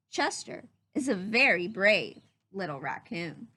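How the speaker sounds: usual speaking voice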